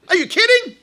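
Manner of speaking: high, loud mock disbelief; incredulous outburst. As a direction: act out a scoffer's indignant comeback. Sinner scoffs back at rabbi.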